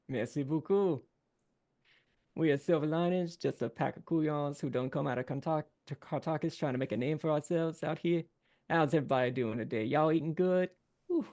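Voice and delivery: lively Southern showman working the crowd; folksy Cajun band frontman. Acted. Frontman greeting diners from the stage, folksy and playful. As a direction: greet the crowd like a Louisiana bandleader.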